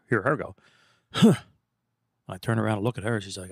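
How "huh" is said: scoffing huh. Retold or imitated. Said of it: "I hear her go".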